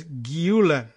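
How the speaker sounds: GULEN said slowly and carefully as "GYOO-len"; careful model of GULEN, "GYOO-len"; guide pronunciation. Shown as a demonstration. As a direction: sound out GULEN with a French-style U. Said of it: "it would be pronounced as".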